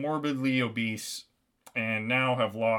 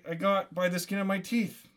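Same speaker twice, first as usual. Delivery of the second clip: plain reading voice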